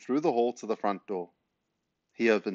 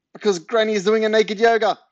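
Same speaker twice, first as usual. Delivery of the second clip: very high, loud, panicked excuse; desperate blurting; 11 semitones higher, 9 dB louder than usual. A narrator's reading. Boy needs excuse to stop neighbour, high loud blurting. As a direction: blurt it out at the top of your range.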